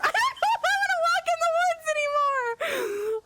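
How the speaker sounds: high, laughing half-cry; laughing whimper. Real, not acted. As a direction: whine it while cracking up. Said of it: "I don't wanna walk in the woods".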